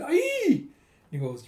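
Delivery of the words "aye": very high, sharp yell as he strikes; a fighter's shout as he smashes the board; battle yell. Acted. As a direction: scream out with the hammer swing. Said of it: "with an".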